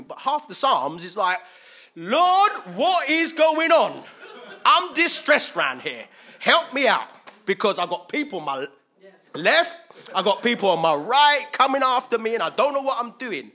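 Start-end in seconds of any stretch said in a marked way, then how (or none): 1.9-13.5 loud, high, desperate plea; crying out. Acted. Preacher acting the psalmist in distress, crying out. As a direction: shout up to God for help. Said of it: "he's crying out to the Lord".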